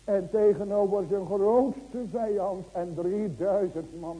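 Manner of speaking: loud, driving sermon voice; preaching at full force to the church; fervent preaching. Acted. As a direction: hammer each question home to the congregation. Preaching peak.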